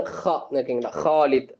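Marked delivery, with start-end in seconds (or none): 0.0-0.5 ஹா as a rasped kh, like hawking up spit; throat-clearing sound. Shown as a demonstration. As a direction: scrape the upper throat on ஹா, as if clearing it. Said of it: "அந்த மாதிரியான உச்சரிப்புல வரக்கூடிய".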